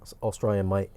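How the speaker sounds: put-on amateur Aussie accent on a short retort; dubious Australian twang. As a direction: insist in a mock-Australian voice. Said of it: "did that sound like an Australian accent".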